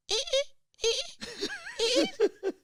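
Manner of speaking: very high squeaky dolphin-like eh; creaking imitation. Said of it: "He goes".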